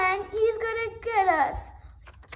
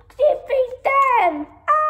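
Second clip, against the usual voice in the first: battle shout; 3 semitones higher, 8 dB louder, slower than usual. Pretend. Toy battle command.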